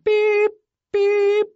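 high electronic beep; imitated alarm beep.

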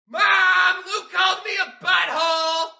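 put-on high shouted kid tattle; excited tattling. Kid tattling to mom for money, gleeful shout.

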